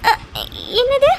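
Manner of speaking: high, loud tearful question. Acted. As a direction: ask it through sobs. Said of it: "இன்னமும் எதுக்கு அபி அழுதுட்டு இருக்க". (அழுகையுடன் கேள்வி)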